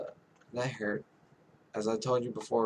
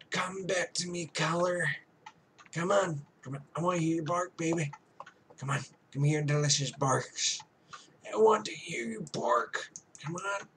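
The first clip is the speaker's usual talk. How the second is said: higher, coaxing pet voice; higher voice, pleading with a pet; coaxing the dog; 6 semitones higher than usual. Pretend. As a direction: sweet-talk the dog into barking.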